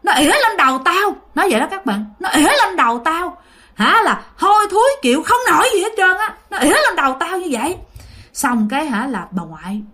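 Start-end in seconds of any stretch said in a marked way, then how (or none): 0.0-1.2 high, indignant complaint; angry spirit. Acted. Spirit answering the Khmer healer, indignant. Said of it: "Thì vong đó mới trả lời". 2.2-7.8 high, indignant complaint; angry spirit. Acted. Spirit answering the Khmer healer, indignant. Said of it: "Thì vong đó mới trả lời".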